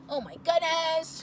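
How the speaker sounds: loud, slow, high cry of panic; panicked exclaim. Retold or imitated. Panicking about having no experience, a loud slow cry. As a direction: wail it out in mock alarm.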